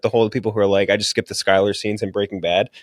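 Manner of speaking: usual speaking voice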